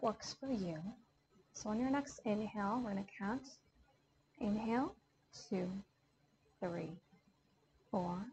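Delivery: calm yoga teacher counting out a box breath; guided meditation. Acted. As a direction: talk the class gently through each breath.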